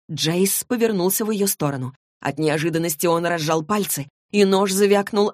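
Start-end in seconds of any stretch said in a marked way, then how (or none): none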